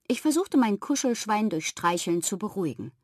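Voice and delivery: usual speaking voice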